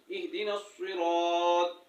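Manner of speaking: सिरात with an emphatic heavy s, slow; heavy saad. Shown as a demonstration. Teacher showing students the right s, heavy and slow. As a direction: stress the heavy स्वाद in सिरात. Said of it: "स्वाद पढ़ना है".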